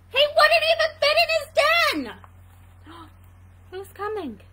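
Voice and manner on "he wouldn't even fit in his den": high, loud, fast outburst; exasperated narration. A narrator's reading. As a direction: rush it out in exasperation. Climax of hedgehog's frustration.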